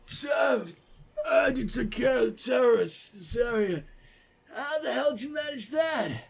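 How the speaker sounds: hammed-up twitchy addict, high-pitched; meth addict voice. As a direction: play a jittery addict. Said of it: "I'm done with the meth addict voice".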